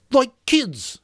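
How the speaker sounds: put-on Piggy scorning the boys who ran off; scornful. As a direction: look down on them. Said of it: "he said scornfully".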